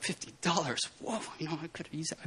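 hushed disappointed aside; muttered aside. Retold or imitated. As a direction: mutter it to yourself, a little let down. Private grumble about the money.

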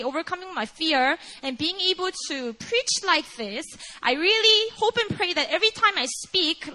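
usual speaking voice

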